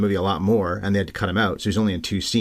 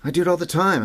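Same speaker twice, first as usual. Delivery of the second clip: higher, eager confession; lightly voiced Ted; 9 semitones higher than usual. Podcast host quoting Ted's nervous line to the cops, higher.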